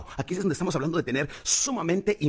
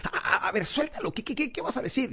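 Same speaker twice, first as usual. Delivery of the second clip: eager audience; 10 semitones higher than usual. Retold or imitated. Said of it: "la gente como que está diciendo".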